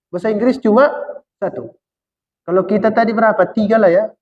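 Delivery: ordinary delivery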